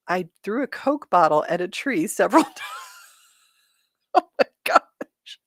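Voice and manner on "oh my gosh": high, slow, laughing oh my gosh; laughing disbelief. Real, not acted. Cracking up at a viewer's funny comment, laughing. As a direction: let the giggle pull it way up.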